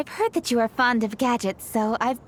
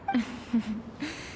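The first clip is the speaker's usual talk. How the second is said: soft chuckle; 4 dB quieter than usual. Acted. Pleased she came prepared.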